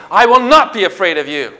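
bold shouting back. Shown as a demonstration. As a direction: declare it firmly against fear. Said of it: "speak in these kinds of ways".

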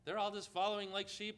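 usual speaking voice

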